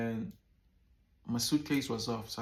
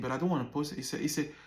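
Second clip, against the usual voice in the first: normal talking voice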